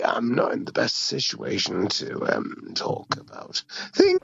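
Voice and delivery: hoarse, half-whispered, cracking; lost voice. Real, not acted. Sick caller explaining he can barely talk, voice nearly gone. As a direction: push words through a wrecked throat. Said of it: "you sound like you've been smoking darts".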